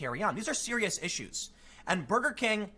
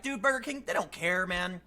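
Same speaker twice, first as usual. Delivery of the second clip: usual speaking voice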